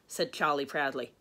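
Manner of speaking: ordinary delivery, nothing marked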